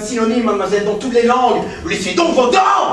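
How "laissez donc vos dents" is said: loud, snapping command; exasperated professor yelling at his pupil; angry outburst. Acted. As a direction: bark at her to drop it. Professor loses patience with pupil.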